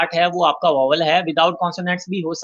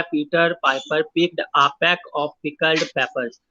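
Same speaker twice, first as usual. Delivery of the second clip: very slow, each p sound placed; slow tongue twister; much slower than usual. Shown as a demonstration. Teacher demonstrates tongue twister to students, slowly. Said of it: "पा sound की repetition".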